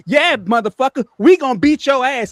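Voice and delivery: high, loud imitation of Joy backing him up; imitating Joy shouting. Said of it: "'cause this is Joy".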